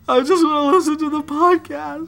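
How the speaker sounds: high, pleading fake distress; mock whimper. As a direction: plead like a hammy victim. Mock terror skit closing plea.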